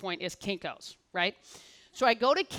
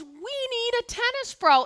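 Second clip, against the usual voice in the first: much higher and slower, each word landed; excited news; 13 semitones higher, 4 dB louder than usual. Retold or imitated. Manager telling Molly they need a pro, high and slow. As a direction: drop the big news slowly.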